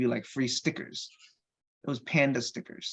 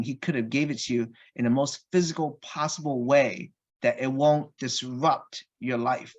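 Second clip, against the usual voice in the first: usual speaking voice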